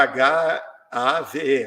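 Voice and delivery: "H A V E" spelled letter by letter, slowly. Shown as a demonstration. Teacher spelling the word for the listener, slow. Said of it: "a palavra".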